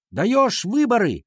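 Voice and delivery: high, slow rallying cry; cheering shout. A narrator's reading. Class demanding elections, high rallying shout. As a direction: chant the slogan high and drawn out. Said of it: "опять закричали мы".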